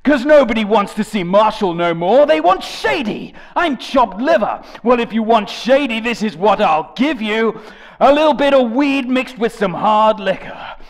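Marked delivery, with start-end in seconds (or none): none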